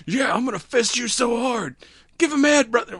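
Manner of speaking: high, loud hammy brute; macho cartoon voice. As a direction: bellow it like a cartoon bruiser. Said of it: "that one didn't sound right".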